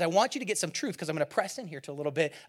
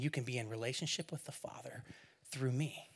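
soft whispered promise; Jesus whispering his promise; 5 semitones lower, 13 dB quieter than usual. Acted. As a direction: whisper it like a secret gift.

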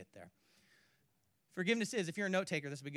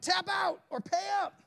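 loud, high, slow fight-announcer bark; hammed-up wrestler taunt; mock wrestler shout; 8 dB louder than usual. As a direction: yell it like a cage fighter. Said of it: "I'm like".

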